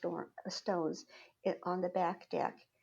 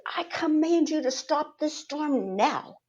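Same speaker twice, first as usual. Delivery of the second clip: loud, high, slow commanding shout; screamed command; 7 semitones higher, 9 dB louder, slower than usual. Retold or imitated. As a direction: shout it at the sky with total authority. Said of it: "I just screamed".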